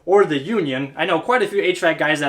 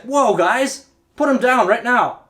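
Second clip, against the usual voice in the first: higher, alarmed halt order; urgent warrior; 6 semitones higher, slower than usual. Acted. Warrior to the tribe carrying the king, urgently halting them. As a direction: stop them with acted urgency.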